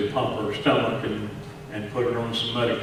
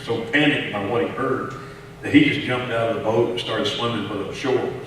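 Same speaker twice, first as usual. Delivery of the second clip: normal talking voice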